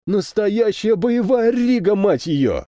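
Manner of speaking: high, drawn-out delighted exclamation; boyish delight. A narrator's reading. Captain thrilled at seeing a real battle Riga, boyish delight. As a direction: marvel at it like an overjoyed boy. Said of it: "Радуется, как мальчишка".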